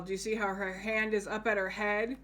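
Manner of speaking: ordinary delivery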